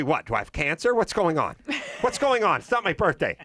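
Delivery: mock alarmed kid. Child self alarmed at offer.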